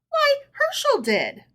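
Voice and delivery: very high innocent voice; as if it were obvious; innocent reply. A narrator's reading. Herschel's wife naming her husband, innocently high. Wife's answer in story.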